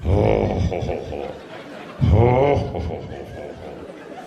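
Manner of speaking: real low rolling laugh, can't stop; long helpless laugh. Real, not acted. Teller cracking up at his own joke, long deep laugh. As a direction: let the laugh take over and roll on.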